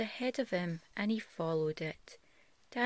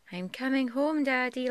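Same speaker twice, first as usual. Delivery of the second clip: higher, louder happy call; 6 semitones higher, 6 dB louder than usual. Acted. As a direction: call out to Daddy. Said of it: "Little Rabbit called".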